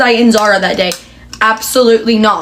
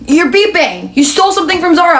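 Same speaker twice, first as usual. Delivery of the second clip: accusing store guard; 8 semitones higher than usual. Retold or imitated. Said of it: "I'm like".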